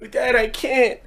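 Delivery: put-on high whiny child; small boy admitting defeat; high-pitched little-kid complaint. Kid giving up on pallet.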